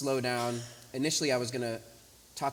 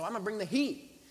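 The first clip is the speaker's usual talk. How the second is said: bringing the heat; 7 semitones higher, 3 dB louder than usual. Shown as a demonstration. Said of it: "told me to bring the heat".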